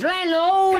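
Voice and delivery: loud, high call for the landlord. Acted. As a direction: shout across the bar. Calling the landlord across the pub.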